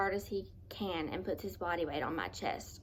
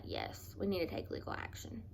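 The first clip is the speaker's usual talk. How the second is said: whispered decision; 7 dB quieter than usual. Retold or imitated. Quiet decision retold.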